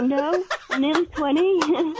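high, drawn-out protest through laughter; laughing protest. Retold or imitated. Young mum retells answering a workmate, laughing protest.

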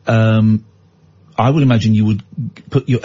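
normal talking voice

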